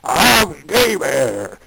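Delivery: big slow mock-monster shout; shouted bear roar. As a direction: roar it out slowly like a storybook bear. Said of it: "it shouted at them".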